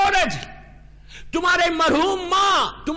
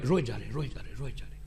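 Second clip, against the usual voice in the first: hushed grief; 12 dB quieter, much faster than usual. A narrator's reading. Preacher describes the Prophet weeping, fading hushed grief. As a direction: voice falling away to near silence.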